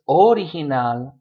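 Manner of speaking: "original" in Spanish with O punched: O-ri-hi-nal; stress on first syllable. Shown as a demonstration. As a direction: hit the opening O of "original". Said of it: "which syllable to stress".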